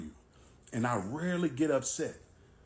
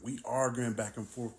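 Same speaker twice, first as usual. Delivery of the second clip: plain reading voice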